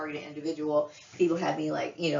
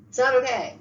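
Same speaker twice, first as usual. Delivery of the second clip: louder and higher, hard insistence; angry insistence; 6 semitones higher, 7 dB louder than usual. Real, not acted. Ranting at a friend's betrayal, louder and higher. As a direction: slam it down as final.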